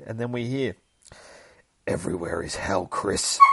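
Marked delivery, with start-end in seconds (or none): 1.8-3.4 low, menacing monster voice; crazy beast. Acted. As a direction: intone it like a demon taunting its prey. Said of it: "crazy-ass beast is like".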